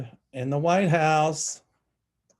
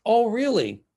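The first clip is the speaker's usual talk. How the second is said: high, loud sceptical "oh, really"; mock surprise; 9 semitones higher, 6 dB louder than usual. Real, not acted. Scoffing at Ted Cruz's holiday tweet, mock surprise. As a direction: pitch it way up in disbelief.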